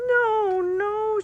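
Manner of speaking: very high, tearful pleading no; pleading cry. Acted. Marie refuses Fritz through tears, high pleading cry. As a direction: beg him like a heartbroken girl. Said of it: "she cried".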